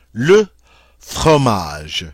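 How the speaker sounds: "Le fromage" with a French uvular r, "fʁomaʒ"; teacher demo of "Le fromage"; French model word. Shown as a demonstration. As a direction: drill "fromage" with a throaty French r. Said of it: "that's how you say".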